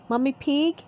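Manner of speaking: ordinary delivery, nothing marked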